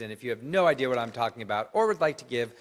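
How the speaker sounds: ordinary talk, nothing marked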